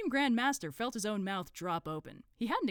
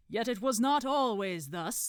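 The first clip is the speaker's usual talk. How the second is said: slow, louder orator, higher than narration; Supreme Grand Master; 5 semitones higher, 7 dB louder, slower than usual. Acted. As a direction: intone it as a pompous cult leader. Grand Master's ceremonial speech.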